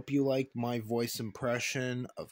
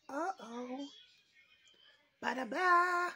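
very high cartoon whoops; Crash Bandicoot voice; 4 dB louder, slower than usual. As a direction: shout it like a zany cartoon hero. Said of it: "trying ... to sound like Jess Hurnell's Crash Bandicoot".

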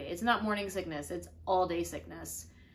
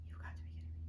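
whispered disbelief, retold; stunned whisper; 14 dB quieter, much faster than usual. Reacting alone to the pregnancy test, stunned whisper. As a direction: barely voice it, as if stunned.

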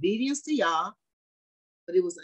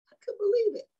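disbelief. Real, not acted. Preacher recalls disbelief.

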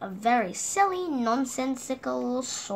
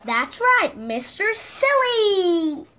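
higher and louder, drawn out Mister Silly; excited announcement; 8 semitones higher, 6 dB louder, slower than usual. A narrator's reading. Narrator to children, introducing Mister Silly with a slow excited cry.

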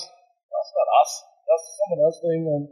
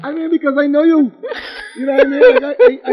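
laughing; 8 semitones higher, 6 dB louder than usual. Real, not acted. Host teasing the new doctor.

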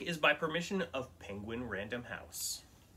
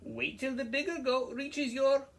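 very high, squeaky goat voice; squeaky little goat; 12 semitones higher, 3 dB louder than usual. Acted. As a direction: plead in a tiny squeak.

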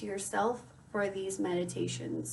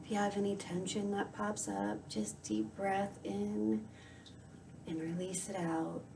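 guided meditation; 9 dB quieter than usual. Acted. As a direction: softly guide the listener into calm, unhurried.

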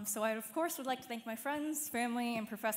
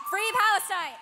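high, loud protest cry; rally shout; 9 semitones higher, 13 dB louder than usual. Real, not acted. Graduate ending the speech with a loud protest cry.

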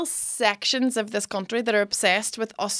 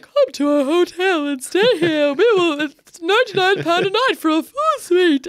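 higher, louder, slow posh ad voice that needs a yawn; funny posh voiceover; 8 semitones higher, 6 dB louder than usual. Retold or imitated. Hotel radio ad voiced on purpose, yawny posh. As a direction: drawl it like a bored posh advert. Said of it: "did, like, a funny voice".

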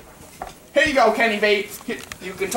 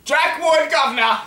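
high-pitched mock-British catchphrase; put-on British accent; 6 semitones higher than usual. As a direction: announce it in a cheeky British accent. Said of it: "his freaking British accent".

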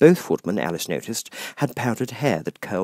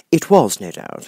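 usual speaking voice

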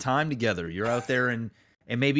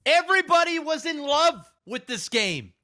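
heartbroken lover's rant; 13 semitones higher than usual. Acted. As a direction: wail it at the game like a jilted lover who's done. Keeps ranting about the game.

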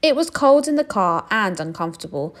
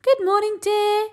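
lightly voiced Missus Bear; 4 dB louder than usual. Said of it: "she said".

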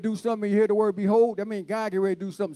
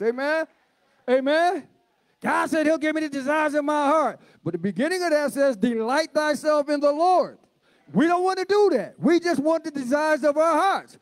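high, insistent pulpit voice; fervent preaching; 8 semitones higher, 3 dB louder than usual. Real, not acted. Preacher corrects church on desires of hearts, fervent preaching. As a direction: exhort the congregation with raised pitch.

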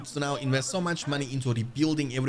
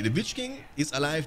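ordinary delivery

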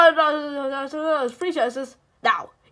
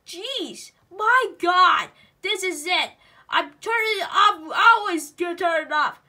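ordinary delivery

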